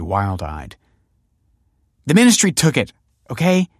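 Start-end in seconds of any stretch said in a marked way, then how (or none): 2.0-3.7 high, loud, exasperated retort; fuming. A narrator's reading. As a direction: blurt it out, fed up. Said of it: "fumed Olive".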